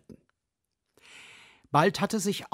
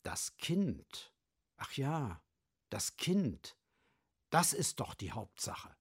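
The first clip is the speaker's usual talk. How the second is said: much softer, sheepish admission; ashamed; 8 dB quieter than usual. Acted. Ashamed ox admits the child matters, much softer. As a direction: hang your head and mumble it. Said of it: "senkte beschämt den Kopf".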